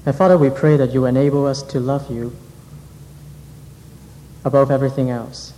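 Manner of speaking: ordinary talk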